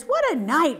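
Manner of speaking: ordinary delivery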